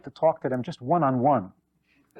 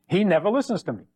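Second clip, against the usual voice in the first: complaining wife; 6 semitones higher than usual. Retold or imitated. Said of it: "She says".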